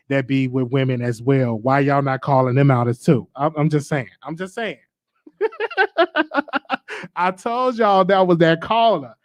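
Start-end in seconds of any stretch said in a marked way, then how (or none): 5.4-7.1 high cackling burst of laughter; real laugh. Real, not acted. Host cracking up over the rude caller, laughing.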